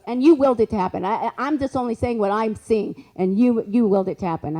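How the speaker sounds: loud, forceful imitation of a clairvoyant; insistent psychic. Storyteller acts out psychic answering a baffled client, loud and insistent. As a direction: push the words out insistently.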